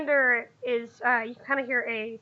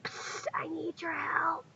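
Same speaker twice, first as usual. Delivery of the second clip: put-on hushed child's whisper; 3 semitones higher, 8 dB quieter, slower than usual. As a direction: whisper it like a secret. Child whispers secret request.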